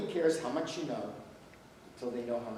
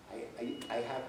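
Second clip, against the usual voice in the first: ordinary talk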